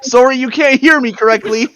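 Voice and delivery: put-on bluffing Canadian guard accent. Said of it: "I have a Canadian accent".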